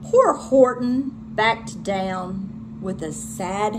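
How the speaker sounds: ordinary delivery, nothing marked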